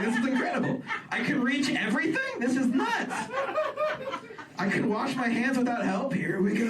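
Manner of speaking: high, giddy wonder; hammed-up awe at reaching things; mock amazement. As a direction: marvel like a kid at everything. His own amazed inner voice.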